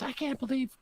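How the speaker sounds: small, very high incredulous squeal; mock freak-out. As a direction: imitate her freaking out in a high voice. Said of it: "she was freaking out for".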